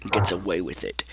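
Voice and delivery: lightly voiced menacing character, low; measured, low warning; Freddy. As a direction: say it low and slow as a threat. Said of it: "you hear Freddy say".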